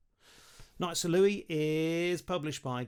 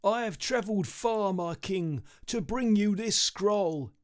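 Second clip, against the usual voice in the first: a touch higher, a tired messenger kneeling; lightly voiced weary messenger; 5 semitones higher than usual. A narrator's reading. Kneeling messenger reports to his king, lightly voiced and weary. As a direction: plead it gently, just a hint of exhaustion. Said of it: "The messenger leant on one knee and said".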